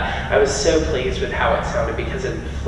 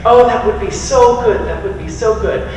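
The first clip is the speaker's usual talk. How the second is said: excited mom; 9 semitones higher, 5 dB louder than usual. Retold or imitated. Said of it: "And she was like".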